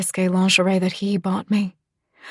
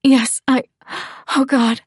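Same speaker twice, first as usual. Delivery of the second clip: higher, louder, overcome moan; raised, halting cry of pleasure; 5 semitones higher, 3 dB louder than usual. A narrator's reading. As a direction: moan it out in broken pieces. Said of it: "I moan".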